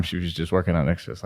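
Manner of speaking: normal talking voice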